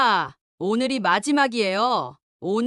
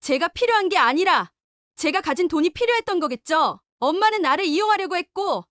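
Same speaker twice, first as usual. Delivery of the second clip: much higher, biting and scornful; bitter scorn; 9 semitones higher than usual. Acted. Daughter rejecting her mother, higher and scornful. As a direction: sneer at her greed.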